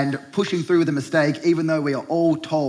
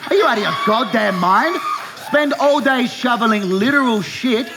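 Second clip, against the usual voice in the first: mock-outraged shout; 6 semitones higher, 3 dB louder than usual. Real, not acted. Ranting at imagined bloke.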